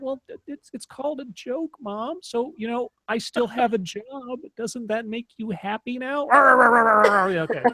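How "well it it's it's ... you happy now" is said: sarcastic to mom. Retold or imitated. Teasing reply to mother.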